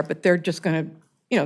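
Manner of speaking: ordinary delivery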